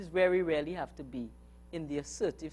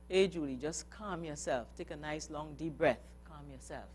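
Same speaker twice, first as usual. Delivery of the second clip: much softer, lower, gently calming; soothing self-talk; 4 semitones lower, 9 dB quieter than usual. Pretend. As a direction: talk yourself down softly.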